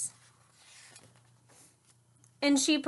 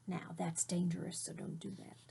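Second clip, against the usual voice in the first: whisper; 4 semitones lower, 12 dB quieter than usual. Real, not acted. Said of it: "teacher aside to children".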